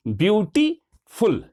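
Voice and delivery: ब्यूटीफुल slowly split as ब्यू-टी-फुल; syllable by syllable. Shown as a demonstration. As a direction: break ब्यूटीफुल into three slow syllables. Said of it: "एक बार हम बोलते हैं ब्यू, फिर आता है टी".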